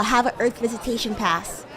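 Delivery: normal talking voice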